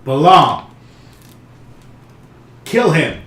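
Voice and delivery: booming divine command, loud and high. Acted. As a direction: thunder out the god's order. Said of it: "say with a booming voice".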